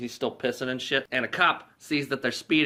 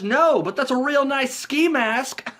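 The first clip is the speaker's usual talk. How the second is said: much higher, louder, slow joke; goofy Lloyd; 13 semitones higher, 6 dB louder, slower than usual. Retold or imitated. Lloyd joking with Mary, hammed-up goofy voice. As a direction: deliver it like a dumb punchline.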